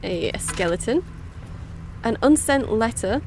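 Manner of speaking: normal talking voice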